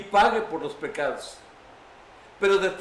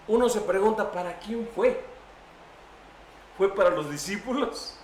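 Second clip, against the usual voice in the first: ordinary delivery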